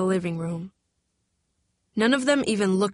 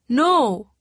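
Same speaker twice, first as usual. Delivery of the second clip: cry of denial; 5 semitones higher, 6 dB louder than usual. A narrator's reading. Vicky's denial.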